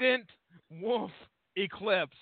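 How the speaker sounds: halting, each word set apart by a pause; "Trident... Wolf... Eclipse", one word at a time; over-careful, stilted "Trident", "Wolf", "Eclipse" with dramatic gaps; William Shatner delivery. Shown as a demonstration. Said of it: "had to turn to William Shatner".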